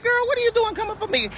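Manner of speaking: squeaky high-pitched disbelief; high incredulous squeal at a hater; mock high exclaim. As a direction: shoot the voice way up, mock outraged. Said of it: "I'm like".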